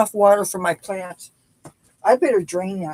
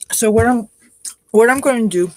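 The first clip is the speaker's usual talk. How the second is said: talking while chewing; chewing steak; 3 semitones higher, 5 dB louder than usual. Real, not acted. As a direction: mouth full. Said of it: "I'm talking with my mouth full".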